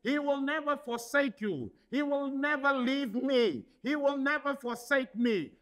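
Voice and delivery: ordinary talk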